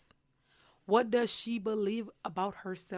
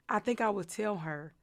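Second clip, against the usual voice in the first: usual speaking voice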